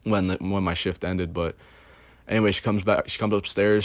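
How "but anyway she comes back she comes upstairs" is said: ordinary talk, nothing marked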